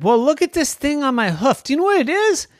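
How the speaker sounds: high, eager little pig; squeaky piglet voice. Acted. Pig character asks horse.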